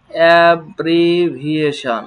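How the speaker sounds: অ্যাব্রিভিয়েশন drawn out slowly as e-bri-bhi-e-shon; slow model word. Shown as a demonstration. Teacher modelling the word slowly for the class. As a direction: spell out অ্যাব্রিভিয়েশন slowly, every syllable clear. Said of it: "teacher models pronunciation".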